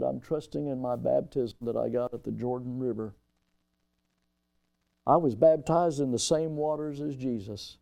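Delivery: soft, low quoted reply; subdued bedside answer. Retold or imitated. Preacher retelling a man's bedside answer about baptism, subdued.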